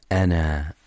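أنا ending in a two-beat long alif; natural madd on pause. Shown as a demonstration. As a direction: stop on أنا and stretch the alif. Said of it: "وتثبت مدا طبيعيا عند الوقف، هكذا". (أنا بمد طبيعي عند الوقف)

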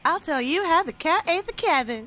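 playful Southern US accent try; Southern accent attempt. Shown as a demonstration. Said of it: "close enough, close enough".

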